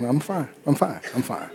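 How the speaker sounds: meek I'm fine. Retold or imitated. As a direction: let each I'm fine die away. Cowed child fading away.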